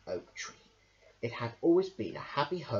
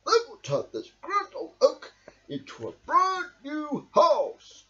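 Badger voice; 13 semitones higher, 6 dB louder than usual. A narrator's reading. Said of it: "called Badger".

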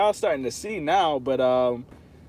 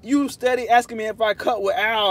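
usual speaking voice

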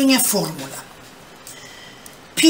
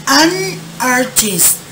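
spoken slowly and clearly for students; slow model sentence; 4 semitones higher, much slower than usual. Shown as a demonstration. Teacher modelling a sentence for students, slow and clear. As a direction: model each word for the class. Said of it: "¿mi oración cómo quedaría?".

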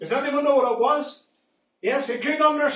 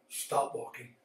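hushed command; 11 semitones lower, 10 dB quieter than usual. Acted. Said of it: "God says to the donkey".

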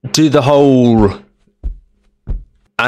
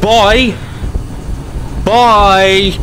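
high gleeful goodbye; excited; 9 semitones higher than usual. Real, not acted. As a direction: shout bye while escaping. Taunting as they escape.

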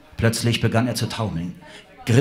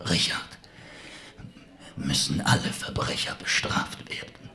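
weak whispering villain; 5 dB quieter than usual. Acted. Dying villain whispers his justification.

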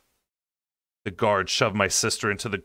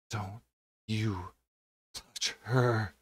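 snarled; 7 dB quieter, much slower than usual. A narrator's reading. Wounded Azrael snarling at the king, quietly furious. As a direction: snarl it at the king through pain. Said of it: "as he snarled at the king".

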